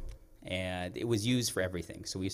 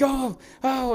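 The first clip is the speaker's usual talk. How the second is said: high, loud startled ohs; acted-out yelps of alarm; mock alarm; 6 dB louder than usual. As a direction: imitate a kid panicking at the wheel. Child startled as truck spins out.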